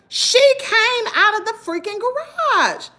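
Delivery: high, slow, pressing point; emphatic accusation. Retold or imitated. Insisting to the police officer, high and slow. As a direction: hammer the point home. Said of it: "telling the police officer".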